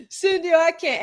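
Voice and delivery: normal talking voice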